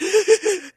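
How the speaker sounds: high, loud mock sobbing gasps; imitated ugly crying. Imitating coworker begging in pantry, ugly crying. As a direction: gasp as if bawling hard. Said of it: "ugly crying".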